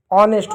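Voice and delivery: ऑनेस्ट with a vowel start; silent H. Shown as a demonstration. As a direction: say ऑनेस्ट without any h. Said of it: "एच silent होगा". (एच बिना "ऑनेस्ट")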